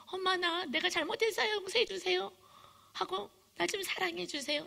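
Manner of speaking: high-pitched little voice, begging; pleading child. Acted. Child asking unforgiving mom for love, pleading. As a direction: plead like a child afraid of rejection.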